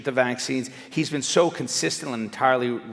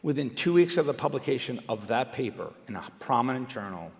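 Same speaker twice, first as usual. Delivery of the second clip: ordinary talk, nothing marked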